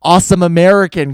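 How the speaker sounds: loud fed-up rant; exasperated outburst. Retold or imitated. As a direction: vent it loudly. Own imagined outburst.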